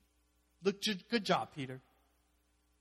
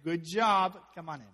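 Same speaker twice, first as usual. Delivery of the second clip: loud, breezy call across the water; cheery loud call to Jesus; mock casual wave-over; 5 semitones higher, 10 dB louder than usual. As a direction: shout it like spotting a friend. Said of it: "Faith would go".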